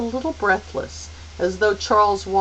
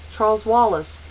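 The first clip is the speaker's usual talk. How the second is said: ordinary delivery, nothing marked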